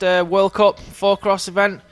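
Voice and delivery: lively live play-by-play; race commentary. Acted. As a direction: call the race as it happens.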